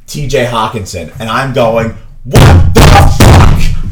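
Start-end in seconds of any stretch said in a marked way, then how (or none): 2.2-3.9 loud furious what the fuck, stretched out slowly; mock outraged yell; retold scream. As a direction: blow up at the trade. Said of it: "I'm screaming".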